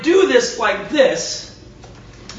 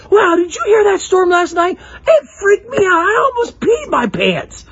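higher, whiny hammed-up fright; mock freaked-out sibling; 7 semitones higher than usual. Sibling tells the sleeper about the storm, mock scared. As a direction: play the scared brother, half crying.

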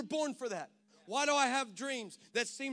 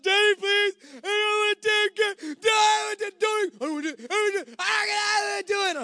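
put-on high, loud shouted child's plea; imitating a daughter's panicked shouting, high; pleading daughter; 10 semitones higher, 10 dB louder than usual. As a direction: wail like a frightened kid begging dad.

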